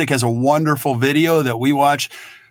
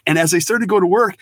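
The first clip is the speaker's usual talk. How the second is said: usual speaking voice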